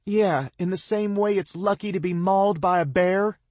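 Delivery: high, loud, bitter yell; injured girl yelling sarcasm up the cliff; sarcastic yell. A narrator's reading. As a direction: fling the joke back. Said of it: "she yells".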